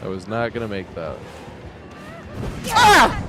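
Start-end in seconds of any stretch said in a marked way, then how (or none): 2.7-3.2 sudden high yell of fright; real jolt of shock, full voice; startled scream. Real, not acted. Jump scare in game.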